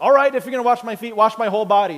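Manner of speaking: high, loud and fast gush; overeager outburst. Retold or imitated. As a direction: blurt it out like an overzealous fan. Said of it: "He's like".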